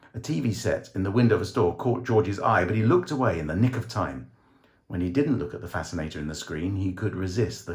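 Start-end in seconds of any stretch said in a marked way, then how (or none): none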